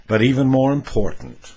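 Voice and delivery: ordinary talk, nothing marked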